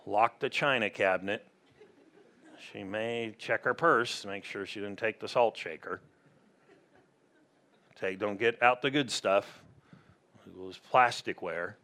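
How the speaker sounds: low, quiet mock-disgruntled host; weary dread. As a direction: grumble under your breath about an unwelcome guest. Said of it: "And God's not thinking like".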